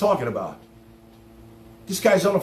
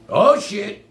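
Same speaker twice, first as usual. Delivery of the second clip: loud, higher mock alarm on Oh, shit; imitating alarm; 6 semitones higher, 6 dB louder than usual. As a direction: blurt Oh, shit out loud, imitating a caller's panic. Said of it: "they're like".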